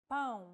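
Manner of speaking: Pão with a nasal ão diphthong; nasal vowel demo. Shown as a demonstration. Teacher shows the tilde nasal vowel to learners. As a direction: say Pão nasally, through the nose. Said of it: "To indicate nasal vowels".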